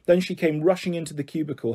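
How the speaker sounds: ordinary delivery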